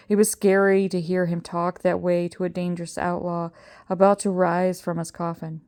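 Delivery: plain reading voice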